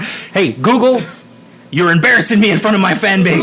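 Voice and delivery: loud, high-pitched telling-off; comic indignant outburst; exasperated scolding. Real, not acted. Scolding a misfiring smart speaker.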